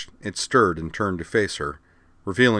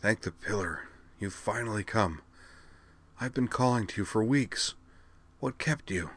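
hushed, worn-out voice with rasp; quiet, raspy little mage, weary from calling; soft raspy voice; 3 semitones higher, 7 dB quieter than usual. A narrator's reading. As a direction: speak softly, as if the voice has been overused. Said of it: "in a soft, raspy voice, like one that has been overused recently".

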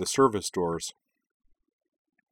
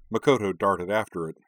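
plain reading voice

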